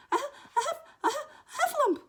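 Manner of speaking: high, loud stammering gasps; breathless Piglet. Acted. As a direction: force the word out in panting squeaks. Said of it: "breathing so hard that he could hardly speak".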